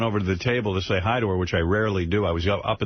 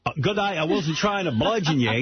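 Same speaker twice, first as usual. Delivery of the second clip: put-on Australian accent; 5 semitones higher than usual. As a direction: imitate a thick Australian bloke. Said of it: "thick Australian accent".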